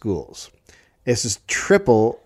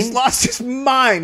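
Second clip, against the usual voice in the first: much higher, slow and incredulous; amazed agreement; 11 semitones higher than usual. Real, not acted. Host agreeing the official went mad, high and slow. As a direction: stretch it out in disbelief.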